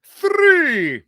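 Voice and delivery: high, loud "Three"; loud counting. Real, not acted. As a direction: call out the count. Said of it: "let's all count together".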